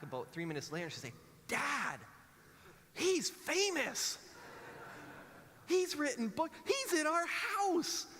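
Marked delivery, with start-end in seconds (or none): 1.5-8.1 put-on very high, drawn-out excited child shriek; thrilled daughter. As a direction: squeal it loud like a starstruck kid. Daughter back from googling, thrilled.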